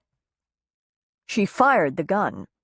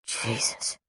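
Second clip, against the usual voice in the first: a soft, voiceless breath of shock; 7 semitones lower, 8 dB quieter than usual. A narrator's reading. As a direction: barely breathe the word out, frightened. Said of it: "Sable breathed".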